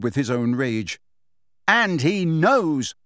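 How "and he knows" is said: slow, higher-pitched gloating; mad taunt. A narrator's reading. Harry taunting Bellatrix, slow and half-mad. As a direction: draw it out as a taunt. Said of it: "said Harry with a mad laugh".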